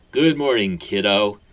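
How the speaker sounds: loud, friendly hello; amiable greeting. Acted. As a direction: greet the boy like a kindly giant. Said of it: "the man greeted amiably".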